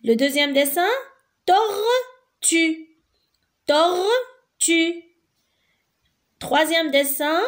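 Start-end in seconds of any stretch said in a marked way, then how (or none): 1.5-5.0 tortue split into tor... tue, raised pitch; high, deliberate tor... tue for tortue; syllable by syllable. Shown as a demonstration. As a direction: sound out tortue in two separate beats. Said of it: "chaque case représente une syllabe".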